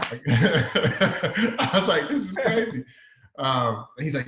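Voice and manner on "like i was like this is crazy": laughing through the words, pitch jumping higher; can't hold back a laugh of disbelief. Real, not acted. Star-struck intern laughing about meeting his hero.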